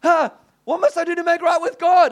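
high, loud desperate asking; urgent plea. Retold or imitated. Champion pleading with the preacher, high and urgent. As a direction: beg for the answer right now. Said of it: "just saying".

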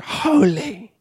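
hushed, high, drawn-out awe; worshipping. Acted. Angels in God's presence, worshipping. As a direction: breathe it out in reverence.

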